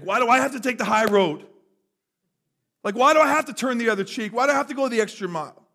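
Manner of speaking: high, loud complaint about unfairness; indignant protest. Acted. Voicing the congregation's complaint of unfairness.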